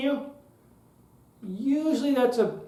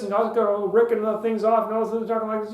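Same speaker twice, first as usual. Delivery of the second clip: fast, ripping through the words; rapid-fire speech opener; faster than usual. Shown as a demonstration. Speaker mimics someone starting a speech too fast, rapid-fire. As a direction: rattle it off in a rush. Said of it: "starts a speech like this".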